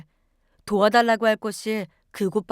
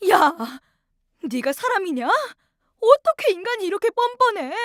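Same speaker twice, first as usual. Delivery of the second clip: furious daughter; 8 semitones higher than usual. Acted. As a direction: hurl the blame at him, voice rising. Daughter confronts husband in fury. (남편에게 따지는 딸, 분노에 찬 높은 목소리)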